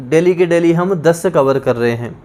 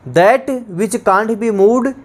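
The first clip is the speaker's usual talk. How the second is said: slow dictation; 3 semitones higher, much slower than usual. Shown as a demonstration. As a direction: spell the phrase out word by word. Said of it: "dictating definition".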